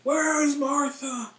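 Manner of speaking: high, slow, drawn-out cry; desperate old man. Retold or imitated. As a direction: beg for Martha, stretched out. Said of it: "He's like".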